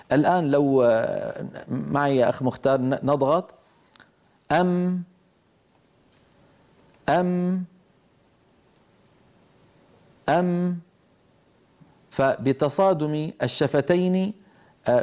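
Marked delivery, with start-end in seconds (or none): 4.5-10.8 "أم" with lips closed on a held, humming m; "أم" on a raised, level pitch, ending in a sustained hum; demonstrating still meem. Shown as a demonstration. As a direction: press the lips together and hold the meem in "أم". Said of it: "الميم الساكنة تخرج بتصادم الشفتين".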